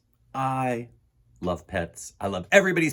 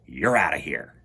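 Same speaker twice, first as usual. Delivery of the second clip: whispered low, a mock threat; 11 semitones lower than usual. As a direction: hiss the goodbye under the breath. Boy threatens fish in story.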